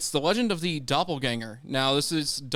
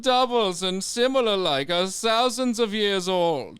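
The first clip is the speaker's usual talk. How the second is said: put-on German accent; 6 semitones higher, 4 dB louder than usual. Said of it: "you want to hear my German accent".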